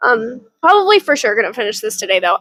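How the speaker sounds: ordinary delivery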